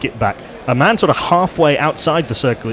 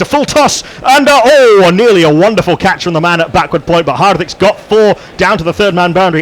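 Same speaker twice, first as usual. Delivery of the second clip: higher and louder, rising to a near catch; excited commentary; 7 semitones higher, 6 dB louder than usual. Real, not acted.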